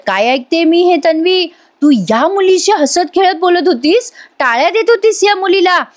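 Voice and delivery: mock-outraged mother-in-law; shocked accusation. Mother-in-law confronting Tanvi in disbelief, accusing.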